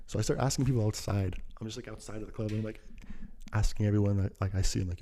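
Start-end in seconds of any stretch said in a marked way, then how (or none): none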